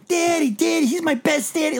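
mocking the daughter in a very high little girl voice. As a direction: parody the daughter's cutesy line in a high child voice. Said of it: "and she".

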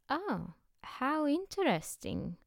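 high, slow, delighted exclamation; exclaiming. A narrator's reading. Foreigner marvelling at the writers, slow high exclaim. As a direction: stretch it out in wonder. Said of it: "exclaimed the foreigner".

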